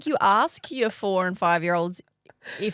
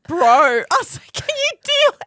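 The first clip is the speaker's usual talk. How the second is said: exasperated; 7 semitones higher, 6 dB louder than usual. Retold or imitated.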